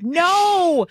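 long, high "no" rising and falling; drawn-out mock protest. Retelling being asked to shag balls, drawn-out mock protest. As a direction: stretch it out in exaggerated protest. Retold plea to Tom.

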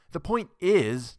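slow, loud and high, cutting him off; breaking in. Acted. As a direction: seize the floor, hitting every word. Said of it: "Norma broke in".

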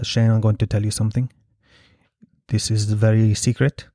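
put-on low, measured Polish accent; Polish-accented coffee shop confidant. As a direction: imitate a Polish woman sharing a secret. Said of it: "racist to do a Polish accent?".

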